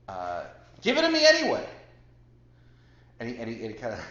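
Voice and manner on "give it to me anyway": high, loud yell across a crowd; retold yell. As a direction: yell it out, reenacting your own heckle. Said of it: "I just yelled".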